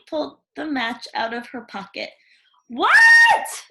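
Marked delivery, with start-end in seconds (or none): 2.7-3.6 very high, loud shriek of surprise; shocked cry. Acted. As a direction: scream it in disbelief. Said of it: "my darlings cried".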